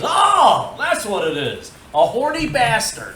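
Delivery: big high, loud "oh" of sudden discovery; loud realisation. Acted. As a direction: burst out as if it just dawned on you. Punchline, Johnny's sudden realisation.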